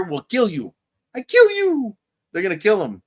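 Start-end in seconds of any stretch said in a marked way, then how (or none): 1.1-2.0 very high, loud cartoonish threat; mock threat. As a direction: squeal it as a hammed-up threat. Hammed-up repeat of retold threat.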